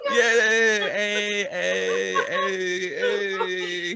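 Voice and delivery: glitchy repeated hey; robotic lag imitation. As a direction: stutter it like a lagging stream. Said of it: "That's what it sounded like".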